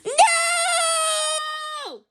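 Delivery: sudden high cry of protest. A narrator's reading. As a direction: blurt out a shocked no. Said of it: "Lizzie cried".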